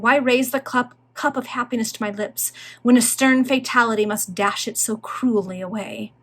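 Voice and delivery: usual speaking voice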